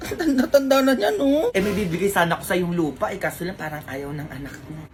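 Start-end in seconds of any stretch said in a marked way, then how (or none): 1.5-4.9 put-on rich old woman. Rich old Mars brags to the mother in a low voice.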